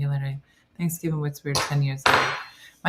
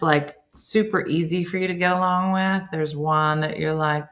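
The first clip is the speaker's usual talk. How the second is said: usual speaking voice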